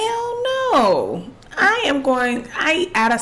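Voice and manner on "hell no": high drawn-out outraged no; emphatic refusal. Real, not acted. Cohost asked to approach an alien, high outraged refusal. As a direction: refuse with rising disbelief.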